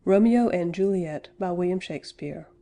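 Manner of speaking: ordinary talk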